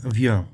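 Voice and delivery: "vient" ending on a nasal "an", T silent; silent final T. Shown as a demonstration. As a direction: say "vient" as "viã". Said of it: "o T você não vai pronunciar".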